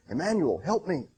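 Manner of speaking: a read-aloud call for rescue, pitch up; mock scream for help. A narrator's reading. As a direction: Peter sinking, crying out to Emmanuel, mildly voiced.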